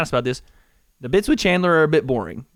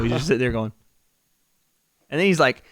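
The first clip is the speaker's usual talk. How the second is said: ordinary talk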